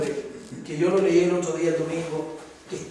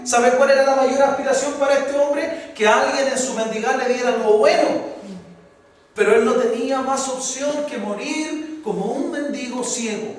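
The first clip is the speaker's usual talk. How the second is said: fiery preaching; 5 semitones higher, 5 dB louder than usual. Acted. Preacher stirring the congregation about the beggar, fiery preaching.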